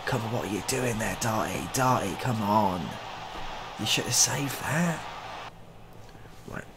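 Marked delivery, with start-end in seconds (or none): none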